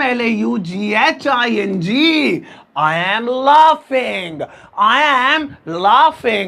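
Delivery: spelled out, then stretched word by word; slow, drawn-out "laughing" for learners; slow dictation. Shown as a demonstration. Teacher spelling and dictating laughing to the class, slow dictation.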